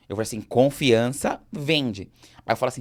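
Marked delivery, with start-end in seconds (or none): 0.4-2.1 very slow, loud, weighted words; drawn-out, punchy sales maxim; emphatic slogan. Retold or imitated. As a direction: land each word heavily and slowly. Retold slogan, weighted word by word.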